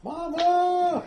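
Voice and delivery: high wailing cry; wailing for mama. Acted. Dragged villager wails for his mama in terror. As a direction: wail for your mother in terror. Dragged captive cries for mother.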